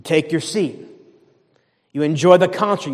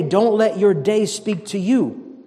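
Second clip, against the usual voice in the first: ordinary delivery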